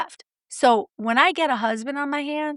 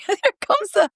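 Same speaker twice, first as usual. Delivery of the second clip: laughing; 10 semitones higher, 4 dB louder than usual. Real, not acted. Flipping the host off, laughing.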